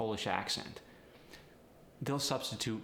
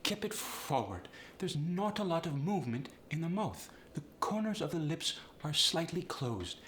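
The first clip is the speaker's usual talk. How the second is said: higher-pitched Polish accent, forward in the mouth; 7 semitones higher than usual. Shown as a demonstration. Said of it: "How about the Polish resonance?".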